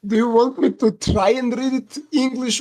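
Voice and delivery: normal talking voice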